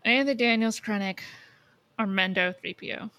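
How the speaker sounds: usual speaking voice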